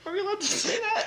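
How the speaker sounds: high voice through laughter; laughing. Real, not acted. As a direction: squeal while laughing. Laughing high after joke.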